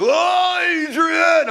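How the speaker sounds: high, loud anguished yell; Rocky crying out. Retold or imitated. Preacher playing Rocky calling for his wife, hammed-up yell. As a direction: wail for Adrian. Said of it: "Rocky's like".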